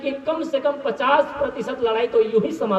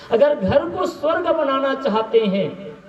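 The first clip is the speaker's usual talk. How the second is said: normal talking voice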